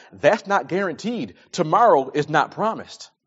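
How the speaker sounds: ordinary talk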